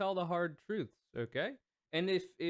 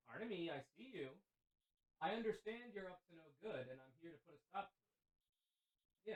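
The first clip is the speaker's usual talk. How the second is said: soft, higher prim reprimand; mock stern scolding; 3 semitones higher, 15 dB quieter than usual. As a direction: quietly lecture the troublemakers. Scolding chat in a put-on voice.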